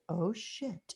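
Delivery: hushed oh shit in disbelief; stunned hushed curse. Retold or imitated. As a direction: mutter it, stunned. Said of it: "Stephanie's answer was".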